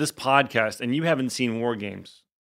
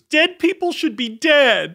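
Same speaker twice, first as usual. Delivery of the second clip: high, slow put-on voice; quoting a character; 13 semitones higher, 4 dB louder, slower than usual. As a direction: drawl it out in a character voice. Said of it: "one of the funniest lines he ever said".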